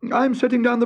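high cheerful little tailor; bright young hero voice. Acted. Tailor speaking.

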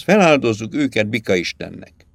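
usual speaking voice

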